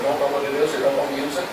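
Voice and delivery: normal talking voice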